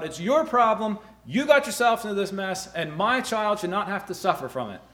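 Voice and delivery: put-on father defending his child, shouting angrily; angry father. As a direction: throw the blame back, fuming. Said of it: "And we get angry".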